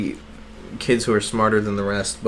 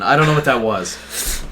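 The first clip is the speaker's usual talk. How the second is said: ordinary talk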